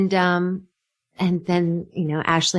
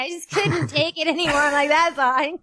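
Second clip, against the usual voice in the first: laughing confession; 7 semitones higher than usual. Real, not acted. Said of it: "You fucked one of them".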